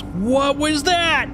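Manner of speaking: high, slow, stunned asking; shocked disbelief. Real, not acted. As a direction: cry out in shock. Shocked after the crash.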